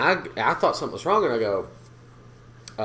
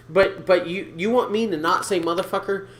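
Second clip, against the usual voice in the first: normal talking voice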